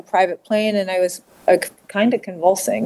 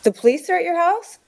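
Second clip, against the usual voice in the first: higher, rising disbelief; alarmed questioning; 10 semitones higher than usual. Retold or imitated. Retelling his news of police at the house, alarmed questioning.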